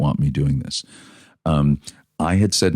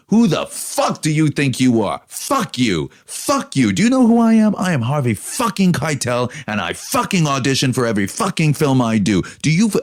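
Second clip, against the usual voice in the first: retold furious tirade; 10 semitones higher than usual. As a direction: hammer every swear word, much higher pitch. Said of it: "It was a tirade".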